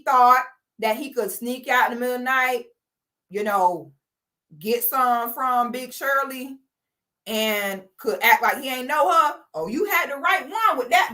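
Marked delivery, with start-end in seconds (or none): none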